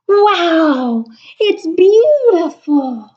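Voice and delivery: high, slow, delighted wow; amazed. Acted. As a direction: marvel out loud.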